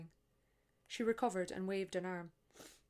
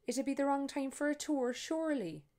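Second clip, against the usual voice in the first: Maureen, wry; 7 semitones higher, 7 dB louder than usual. Acted. Maureen answers the tourists.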